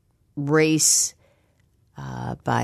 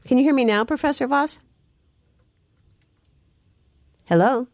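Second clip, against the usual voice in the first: calling out; 10 semitones higher, faster than usual. Real, not acted. Host calling to lost phone guest.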